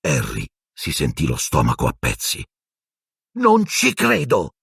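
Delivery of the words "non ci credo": high, drawn-out cry; shouted disbelief. A narrator's reading. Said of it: "gridò Hermione".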